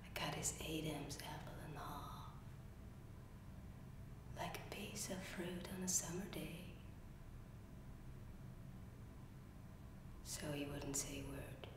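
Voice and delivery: low, very soft near-whisper with long pauses; quiet, halting and secretive; hushed confession. Acted. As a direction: confess a killing under your breath. Acted confession of killing stepfather.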